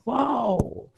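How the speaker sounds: a cheery wow at a flat tyre; upbeat wow; mock wow. Shown as a demonstration. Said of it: "आपने अपनी गाड़ी puncture पे बोला". (खुशी भरा वाओ)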